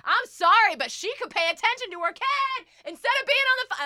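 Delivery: high, loud indignant complaint; indignant mom. Retold or imitated. Mom complaining loudly about the woman in the aisle, indignant. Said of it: "And Mom's like".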